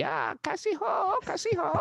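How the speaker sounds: hammed-up Indian accent; broken Hindi. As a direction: greet playfully in broken Hindi. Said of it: "I do a little broken Hindi".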